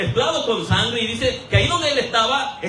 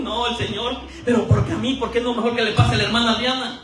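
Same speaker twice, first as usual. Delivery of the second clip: usual speaking voice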